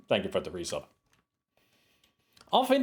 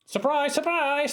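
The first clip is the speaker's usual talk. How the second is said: high, sing-song surprise surprise; spring the witch on the player, much higher; mock surprise. Streamer announces the witch to the player with hammed-up surprise.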